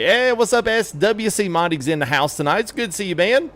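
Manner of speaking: high, bright-pitched hello to a friend; excited greeting. Real, not acted. Streamer greeting the modder in chat, excited and high. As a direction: lift it up for the modder.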